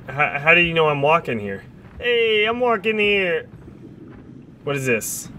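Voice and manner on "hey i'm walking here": slow, high mock New York yell; New York accent. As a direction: do the cabbie I'm walking here. Movie quote callback.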